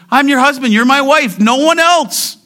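high, loud impassioned declaration; preacher voicing God's jealous insistence; jealous husband. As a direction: proclaim it forcefully, staking an exclusive claim. Voices God as jealous husband.

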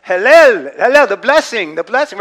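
loud high shouts of Hallel; joyful yell. Retold or imitated. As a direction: cry it out like the crowd at Pentecost. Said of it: "they all began to yell out".

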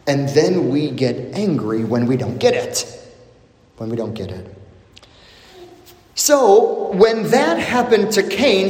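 ordinary talk, nothing marked

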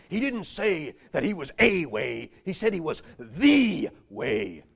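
lightly voiced preacher, stress on the way; mild preacher impression; imitating a preacher. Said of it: "That's how he said it".

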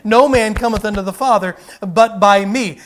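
loud, forceful Bible quote; preached scripture; preaching scripture. Acted. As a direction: proclaim it from the pulpit.

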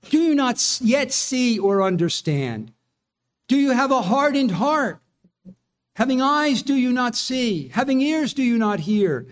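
a teacher scolding pupils who still don't get it; Jesus, exasperated. Retold or imitated. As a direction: press each question with weary frustration. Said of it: "Jesus says".

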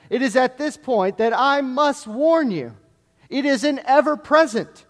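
loud, high, urgent sermon warning; fiery preacher pleading with sinners; preaching at full force. Acted. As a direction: thunder the warning from the pulpit. Sermon builds to warning of hell.

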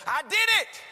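high, loud cry of triumph; excited shout. Retold or imitated. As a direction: burst out with thrilling news. Running up with news of salvation.

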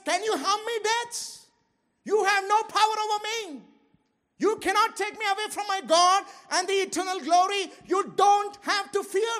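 high, loud triumphant challenge to death; defiant shout. Acted. Preacher voicing a believer taunting death, loud and triumphant. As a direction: taunt death like it has lost.

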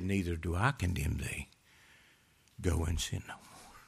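low, soft voice; gentle retold pardon. Jesus pardons the woman.

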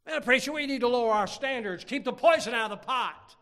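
loud, high exhortation; forceful, emphatic warning; full-force preaching. Real, not acted. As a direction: thunder it from the pulpit.

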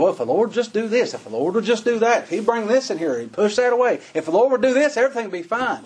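high, eager voice of a dreamer bargaining with God; naive wishful daydreaming. Retold or imitated. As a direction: play the one who keeps telling the Lord what to do. Imitating our own daydream prayers.

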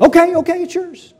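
high-pitched giving in; acted panicky capitulation; flustered surrender. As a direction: cave in hastily under pressure. Naboth caving in, acted.

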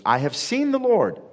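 breathless, joyful "I have seen the Lord"; excited announcement. Acted. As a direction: tell it like the best news you've ever had. Mary tells the disciples.

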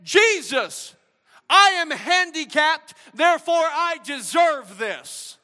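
high, slow self-pitying complaint; mock entitled. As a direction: whine that you are owed this. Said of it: "He didn't say".

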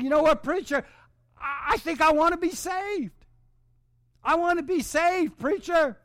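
high, eager plea to the preacher; a convert begging, voice lifted high; a man stepping forward, begging the preacher to save him; pleading to be saved. Retold or imitated. Jim comes forward asking salvation.

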